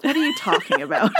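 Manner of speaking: high-pitched, giggly mock bafflement; laughing disbelief. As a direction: break into laughter while protesting. Laughing off negative feedback.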